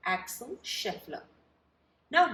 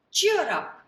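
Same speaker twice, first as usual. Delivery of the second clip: cheerful call; 7 semitones higher, 9 dB louder than usual. Acted. George comforts giraffe.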